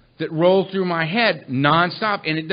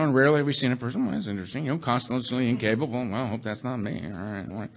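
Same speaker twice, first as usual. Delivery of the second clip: quick mumbled reading, worried asides; a man nervously skimming and mumbling to himself; fast reading with muttered asides; 5 semitones lower, 9 dB quieter, faster than usual. Retold or imitated. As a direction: rattle through the text, dropping to a mutter. Guy reading step, muttering asides.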